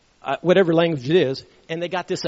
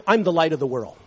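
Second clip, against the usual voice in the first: normal talking voice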